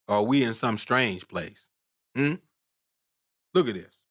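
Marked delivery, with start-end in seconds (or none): none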